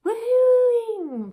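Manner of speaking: very loud, high whoop; soaring, drawn-out "woohoo" yell; party woohooing. Shown as a demonstration. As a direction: belt it out like a New Year's cheer. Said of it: "bringing in twenty nineteen kind of affected my voice".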